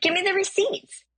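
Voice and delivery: very high, challenging retort; indignant demand. Retold or imitated. Driver challenges stranger's price, indignant. Haggling quarrel over price.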